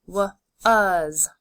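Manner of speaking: W-uz with the A said as uh; W-uz: w then a short uh vowel, then z; sounded out. Shown as a demonstration. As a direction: stretch W-uz into parts, A as uh. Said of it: "the A making an uh sound".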